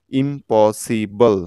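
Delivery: Impossible drawn out slowly in separate syllables; slow teacher's model of impossible; syllable-by-syllable pronunciation. Shown as a demonstration. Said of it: "একইভাবে দেখুন".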